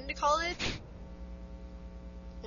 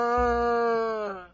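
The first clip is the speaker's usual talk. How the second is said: long, drawn-out whoa; test yell. Shown as a demonstration. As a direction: hold one long open cry. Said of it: "How was that?".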